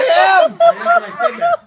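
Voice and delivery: high, loud, slow words through laughter; laughing loud. Real, not acted. The gecko jumped right after his remark, loud laughter.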